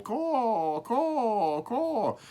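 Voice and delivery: three high crow caws, calling out. A narrator's reading. Crow calling again for other crows, high caws. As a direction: caw high like a lonely crow. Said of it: "Then again he called".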